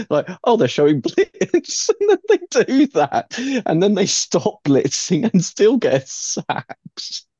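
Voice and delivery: talking through giggles, pitch jumping up; giggling. Real, not acted. Seahawks fan recounting the surprise blitzes, giggling. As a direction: try to explain while giggling. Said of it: "Sorry, I'm giggling".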